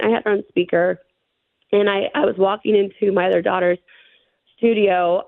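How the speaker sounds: usual speaking voice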